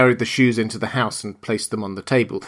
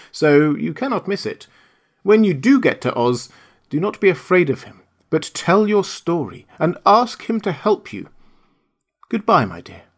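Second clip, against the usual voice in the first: put-on lightly raised, gentle old lady voice; kindly old witch; 7 semitones higher, 3 dB louder than usual. Witch of the North tells Dorothy she must stay, lightly voiced kindly old woman. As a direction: break the news kindly, like a grandmother.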